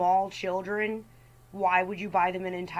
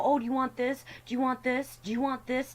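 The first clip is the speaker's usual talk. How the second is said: higher, sing-song offering; a parent coaxing a crying child with treats; 5 semitones higher than usual. Retold or imitated. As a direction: dangle treats like a desperate parent. Parent offering things to crying kid.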